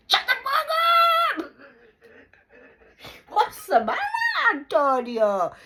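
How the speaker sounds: high, loud hammed-up snap; mock angry shout. Brother-in-law snapping at her in customs, mock shout.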